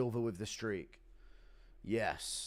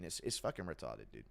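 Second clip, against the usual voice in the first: normal talking voice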